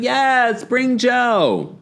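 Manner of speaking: high chirpy mock agreement; mock eager Heather. Host imitates Heather cheerfully agreeing to Tamra, high. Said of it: "And she's like".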